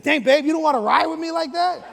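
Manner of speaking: loud, high comic protest; calling out, mock hurt. Husband calling to wife at other end of train, mock hurt. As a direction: holler after her in comic disbelief. Calls after wife who walked off.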